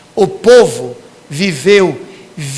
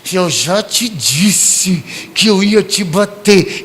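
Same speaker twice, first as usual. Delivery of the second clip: slow, eerily calm warning; much slower than usual. Retold or imitated. Parent warning a repeat offender, slow calm voice. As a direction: calmly remind before the slap. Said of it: "Bem calmo".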